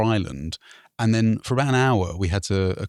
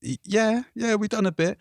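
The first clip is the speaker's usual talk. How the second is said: nervous reply; 10 semitones higher than usual. Retold or imitated. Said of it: "we were like".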